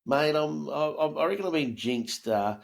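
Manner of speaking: ordinary talk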